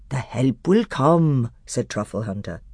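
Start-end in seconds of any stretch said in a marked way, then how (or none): 0.1-1.5 slow, steady faith; calm badger. A narrator's reading. Badger reassuring the council, slow and steady. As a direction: say it as a promise.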